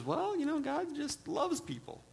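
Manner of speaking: high, slow mock-pious voice; syrupy sweet. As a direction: coo it like a naive do-gooder. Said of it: "the kind of person that says".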